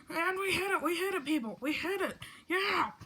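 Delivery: loud, high triumphant cheering; excited shout. Acted. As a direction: celebrate hitting midnight. Celebrating the new year.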